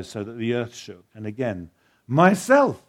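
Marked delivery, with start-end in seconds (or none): 2.1-2.8 a ringing, joyful, much louder and higher declaration; loud and clear. Acted. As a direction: proclaim it bright and glad.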